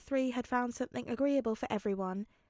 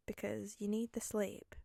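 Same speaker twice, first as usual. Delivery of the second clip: ordinary delivery, nothing marked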